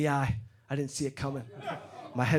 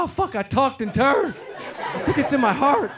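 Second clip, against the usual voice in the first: mock panic; 8 semitones higher than usual. Telling his buddy about the neck pain, hammed-up panic. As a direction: ham up the panic, voice up high.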